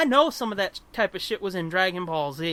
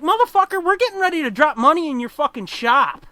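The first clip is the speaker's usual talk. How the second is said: much higher, louder indignant rant; mock outrage; 7 semitones higher, 6 dB louder than usual. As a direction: vent at a rude shop owner. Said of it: "And it's like".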